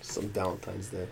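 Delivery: very quiet, low grumble; sulky mutter. Real, not acted. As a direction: sulk under your breath. Embarrassed friend sulks after teasing.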